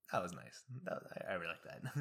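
low, soft murmur to oneself; quietly touched. Retold or imitated. Driver alone after a kind exchange, soft low aside. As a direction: say it small and pleased.

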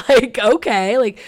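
slow, loud laugh over the words. Real, not acted. Vlogger laughing at the teacher's surprising offer. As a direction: laugh in disbelief.

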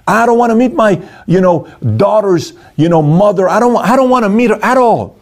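high-pitched, stubborn refusal; whiny mock refusal, pitched well up; stubborn in-law. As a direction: dig in your heels like a sulky relative. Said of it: "Think about an in-law, don't wanna meet".

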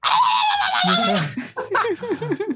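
high-pitched gibberish hollering; imitated foreign yelling. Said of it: "berrando na tua orelha".

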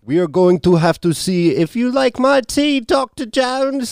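high, slow, hammed-up Russian accent. Shown as a demonstration. Host outdoing an actress's accent, Russian accent. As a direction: draw it out loftily in a mock Russian accent. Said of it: "I could do a better Russian accent".

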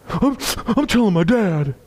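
put-on high tattling kid voice; whiny kid. Bullied kid threatening the preacher as a boy, high whiny voice. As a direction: play the tattling little boy.

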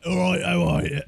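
anti-ASMR. Pretend. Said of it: "I wanna do that anti-A S M R".

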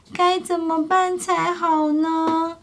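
high, slow little voice pondering; worried thinking. Acted. Baby pterosaur wondering what to do, slow worried voice. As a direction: mull it over like a worried little one. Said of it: "小翼龙心想".

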